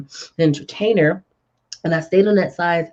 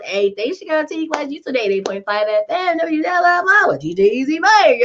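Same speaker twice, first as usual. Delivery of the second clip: high, breezy imitation of a radio intro; playful on-air announcer voice; radio host patter; 10 semitones higher than usual. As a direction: rattle off a station ID like a host. Acts out her radio host intro.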